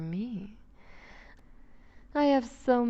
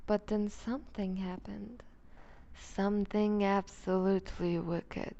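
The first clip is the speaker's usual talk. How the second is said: ordinary delivery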